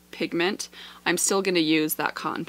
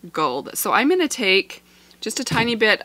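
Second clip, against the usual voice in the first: ordinary delivery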